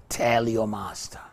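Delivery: normal talking voice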